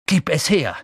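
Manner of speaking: loud demand; calling out. Acted. Harry calling to Malfoy in the air, loud. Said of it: "rief Harry".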